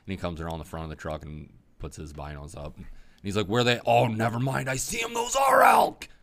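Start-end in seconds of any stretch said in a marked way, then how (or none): 3.5-6.1 louder, rising thrill at the sight; hunting buddy spotting elk, thrilled; excited exclaim. Retold or imitated. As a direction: burst out as the elk appear.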